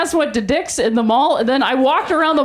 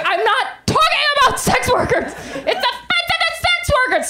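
high, laughing outrage; mock offended heckler; 11 semitones higher than usual. Imagined offended audience member, high mock outrage. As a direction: parody an indignant scold. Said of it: "And be like".

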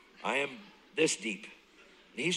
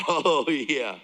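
emphatic admission; 7 dB louder than usual. Retold or imitated. Retold admission of guilt.

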